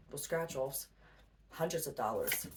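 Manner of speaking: ordinary talk, nothing marked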